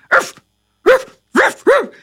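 sharp, high dog barks; barking. Acted. Caleb the dog wakes and confronts intruders, barking. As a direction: bark like a dog leaping up. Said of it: "was instantly up and barking".

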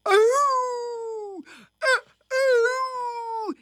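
long, high imitation howl; dog howling. A narrator's reading. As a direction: howl like a hound, drawn out. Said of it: "and howled outside".

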